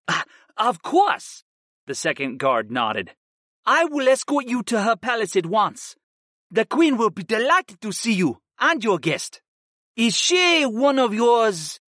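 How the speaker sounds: a guard scolding his comrade. A narrator's reading. Second guard voiced in story.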